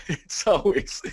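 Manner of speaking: high-pitched laugh through the words. Real, not acted. Help desk worker recalling clueless questions, laughing. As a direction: break up laughing while speaking.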